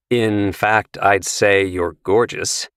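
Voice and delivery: plain reading voice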